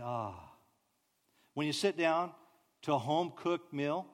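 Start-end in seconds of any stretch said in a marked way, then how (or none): 0.0-0.5 soft, low ahh of relief; a quiet contented sigh; deep satisfied ah. Shown as a demonstration. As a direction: let it out like a deep breath. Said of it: "Every chance you get, say".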